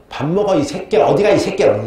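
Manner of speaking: high-pitched mock scolding; imitated cursing. Servant girl calling the old king to his meal, mock cursing. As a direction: swear at him as the servant girl would. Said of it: "그 여자가 와서".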